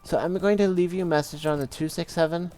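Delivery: high-pitched imitation of an old Polish lady; badly faked old Polish lady leaving a voicemail. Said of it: "Polish lady".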